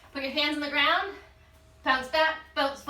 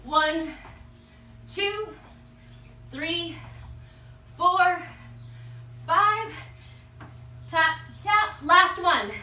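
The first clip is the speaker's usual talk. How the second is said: higher-pitched count during jump squats; calling out reps; 5 semitones higher, much slower than usual. Real, not acted. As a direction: call each rep out with effort. Coach calls out exercise reps while jumping.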